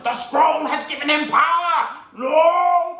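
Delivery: high, loud villain roar, drawn out; hammed-up villain shouting slowly; Tai Lung. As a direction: cry out in outraged disbelief. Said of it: "And Tai Lung goes".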